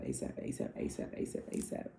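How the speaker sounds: asap fading into a whisper; whispered repetition. Real, not acted. Vlogger hammering home asap, fading to a whisper. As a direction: let it trail off, whispering.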